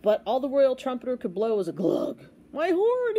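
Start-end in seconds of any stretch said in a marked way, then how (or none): 2.5-3.2 high, startled gulp; gulped dismay. A narrator's reading. Trumpeter finds his horn clogged, gulped in dismay. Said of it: "he gulped".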